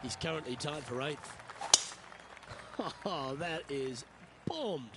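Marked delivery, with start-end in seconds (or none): 1.4-3.0 quick breathy laugh; short laugh. Real, not acted. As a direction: laugh at the shot. Reacting to a bombed putt.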